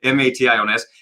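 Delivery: ordinary talk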